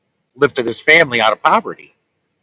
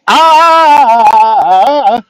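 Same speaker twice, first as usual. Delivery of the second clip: high, loud chant yell; hammed-up Kamala cry; belted mock war cry; wrestler war cry; 9 semitones higher, 6 dB louder than usual. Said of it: "I went, "Ah, ah".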